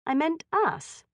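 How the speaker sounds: slightly hurt, stung reply; a little injured. A narrator's reading. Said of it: "a little injured".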